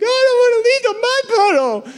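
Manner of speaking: put-on very high whiny kid voice; whiny child. Boy refusing to leave the mud puddle, whiny. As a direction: whine like a stubborn little boy.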